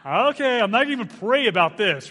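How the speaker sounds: loud laughing surrender; loud, high, laughing give-up. Retold or imitated. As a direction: cry it out half laughing, beaten. Laughing giving in to God.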